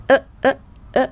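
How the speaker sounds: short separate "ơ" sounds sent toward the nose; hơi lên mũi. Shown as a demonstration. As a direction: pulse "ơ, ơ, ơ" through the nose. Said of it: "luồng hơi nó sẽ được xử lý trên mũi". (đọc "ơ" ngắn, dồn hơi lên mũi)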